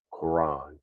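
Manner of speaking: Quran said slowly in New York accent, like crayon. Shown as a demonstration. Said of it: "it's just my New York accent".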